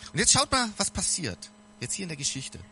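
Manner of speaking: ordinary delivery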